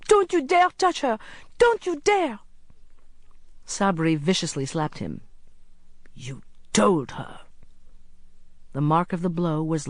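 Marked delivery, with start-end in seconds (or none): 0.1-2.4 put-on high, loud, fierce boy's warning; indignant child standing up to a bully; defiant cry. A narrator's reading. Boy defends friend from attacker. 6.1-7.4 slow, whispery accusation; menacing villain's slow half-whisper; accusing. A narrator's reading. Villain accuses boy after slapping him.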